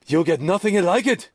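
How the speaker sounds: mock judge line. Quoting the Caddyshack judge.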